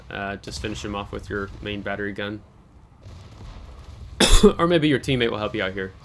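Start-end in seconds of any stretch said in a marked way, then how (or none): 3.0-4.5 harsh dry cough. Real, not acted. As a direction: cough hard, unvoiced. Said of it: "I can't stop coughing".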